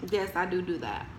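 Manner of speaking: usual speaking voice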